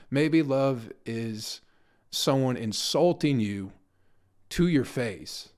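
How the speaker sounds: drawn out slowly, word by word; slow down for the punchline; slow deliberate punchline. Real, not acted. Comic punchline delivery.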